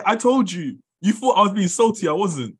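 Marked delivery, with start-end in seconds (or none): none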